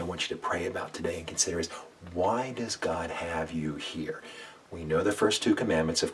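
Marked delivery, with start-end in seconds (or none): none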